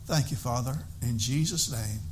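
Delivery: earnest pastor's prayer. Acted. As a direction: pray aloud to the congregation. Opening prayer.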